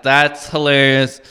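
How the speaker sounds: ordinary talk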